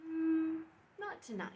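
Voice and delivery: plain reading voice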